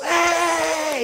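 loud high shout of the crowd; a crowd's loud cheering cry. Retold or imitated. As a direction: whoop as the whole crowd egging them on. Crowd cheers as they climb.

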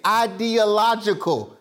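"ideological" slowly, syllable by syllable; slow down to get "ideological" out cleanly; measured, deliberate "ideological" after a stumble. Shown as a demonstration. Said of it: "It just gotta go slower".

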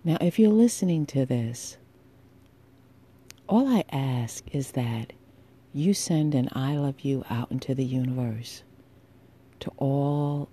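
ordinary delivery

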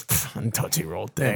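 low, soft, scoffing echo; muttering sidekick. Retold or imitated. Ben Affleck's character just sitting there, muttered mock echo. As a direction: mutter it under your breath, imitating him. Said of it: "just sitting there and he goes".

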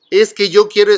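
mock a child insisting; a hint of a child wanting his toy; whiny child demand. Parent voices child's demand.

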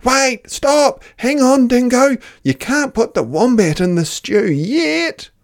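high, loud urgent call; urgent shout. Acted. The animals shout to stop Dingo. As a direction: stop him before it's too late.

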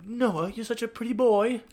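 high, loud mock fawning voice; different tone. Shown as a demonstration. Teasing Noah with mock compliments, high and loud. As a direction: gush over Noah in a silly high voice. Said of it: "I'd have to say it, like, a different tone".

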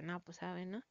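a lightly voiced baffled girl; clueless shrug. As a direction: mumble it like you've no idea. Girl left confused, shrugging.

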